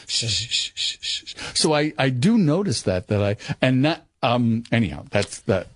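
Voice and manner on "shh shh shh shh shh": whispered shush; imitating dad shushing. Dad hushing son's TV news, whispered shush. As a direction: hush it breathily.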